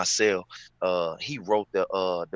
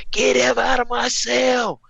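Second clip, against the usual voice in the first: screaming inmate; 14 semitones higher, 6 dB louder than usual. Retold or imitated. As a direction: scream it like a furious cellmate. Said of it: "I heard him screaming".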